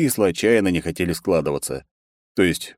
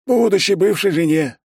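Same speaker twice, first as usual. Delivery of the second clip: high, loud, chirpy character voice; eager correction; 13 semitones higher, 5 dB louder than usual. A narrator's reading. Secretary readily correcting himself to the boss, high and eager. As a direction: repeat it high and all too willingly. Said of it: "охотно поправился этот".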